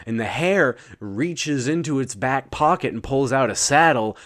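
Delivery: ordinary delivery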